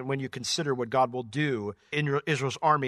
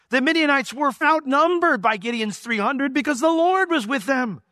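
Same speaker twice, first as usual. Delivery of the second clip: higher, louder preaching build; rising sermon; 6 semitones higher, 5 dB louder than usual. Acted. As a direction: drive the conviction home to the congregation. Preaching build on God's favor.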